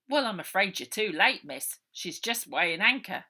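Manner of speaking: loud official brushing them off; a touch of the self-satisfied gateman; gate man voice. Acted. Gate man refuses entry.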